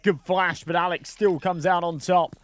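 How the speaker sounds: rising energy on a headshot; excited play-by-play. Acted. Tense clutch call.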